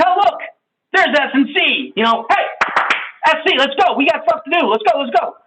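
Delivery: high, driving hurry-up; the D teammate rushing S and C inside, high and pushy; pushy D type. Acted. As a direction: herd them in, no time to waste. D arrives pushing everyone in.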